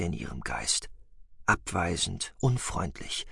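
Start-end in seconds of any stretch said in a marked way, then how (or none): none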